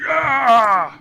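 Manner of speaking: high, loud monster roar; dopey cartoon bellow. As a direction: bellow it like a dumb giant. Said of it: "That was a good accent".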